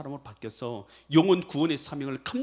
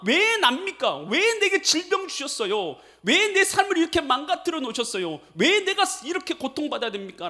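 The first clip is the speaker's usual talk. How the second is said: raised, pleading complaint to God; complaining prayer; 9 semitones higher, 4 dB louder than usual. Retold or imitated. Sick man grumbling before God, raised lament. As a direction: protest why me, voice lifted. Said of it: "라고 원망하던 그 마음에서요".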